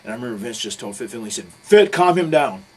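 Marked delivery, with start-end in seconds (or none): 1.6-2.6 slow, loud, raised-pitch command; big drawn-out retold shout; shouted order. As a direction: imitate a boss calling out an order. Retold boss barking an order.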